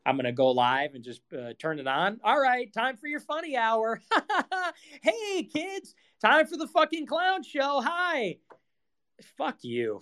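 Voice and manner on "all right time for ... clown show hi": much higher, hammed-up kids' show voice; mock clown host. Streamer sarcastically playing a kids' show clown, high hammed-up. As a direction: play a fake cheery clown MC.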